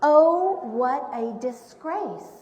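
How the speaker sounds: projected, rhyming picture-book narration; story reading to children. A narrator's reading. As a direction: read the rhymes out with big, deliberate emphasis.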